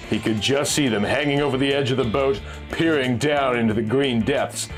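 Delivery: ordinary delivery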